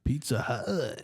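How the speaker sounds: slow, savored brand call; slow mock jingle. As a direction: stretch it out like an old ad tag. Finishes co-host's line as jingle.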